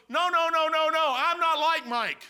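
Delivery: loud protest. Retold or imitated. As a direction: wave it off insistently. Chrysler protesting, retold.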